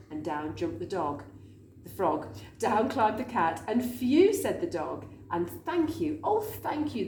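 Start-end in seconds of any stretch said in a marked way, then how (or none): 3.8-4.3 relieved phew. Acted. Dog relieved. 5.6-7.1 high, heartfelt thank you; grateful thanks. Acted. Grateful witch thanks her friends, high and heartfelt. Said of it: "the grateful witch cried".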